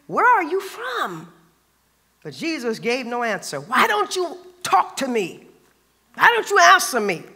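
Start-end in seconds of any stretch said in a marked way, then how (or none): 0.1-1.3 higher, slow, searching question as Pilate. A narrator's reading. Pilate asking Jesus where he is from, slow and higher. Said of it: "asked him". 3.6-7.2 Pilate demanding. A narrator's reading. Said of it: "Pilate demanded".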